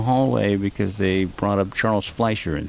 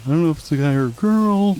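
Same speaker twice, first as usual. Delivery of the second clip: higher, fast sneering parody; mocking the host; 6 semitones higher, 4 dB louder, faster than usual. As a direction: mimic him to mock him. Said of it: "fifteen minutes are about".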